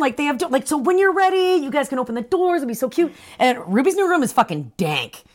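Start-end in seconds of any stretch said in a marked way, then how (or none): 0.7-3.1 pitched-up imitation of a delighted father; playful doting enthusiasm; mock gushing. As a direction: gush over the plan in a higher voice. Father gushes about bedrooms.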